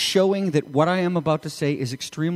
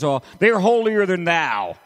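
raised, sweeping quoted jibe; mock disapproval; 3 semitones higher, 4 dB louder than usual. Preacher quoting a familiar jibe, mock disapproval. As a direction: toss it out like a common put-down. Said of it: "we've all heard the phrase".

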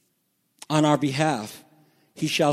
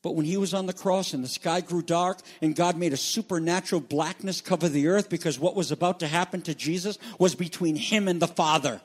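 ordinary talk, nothing marked

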